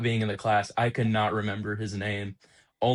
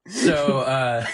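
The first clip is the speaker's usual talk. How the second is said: usual speaking voice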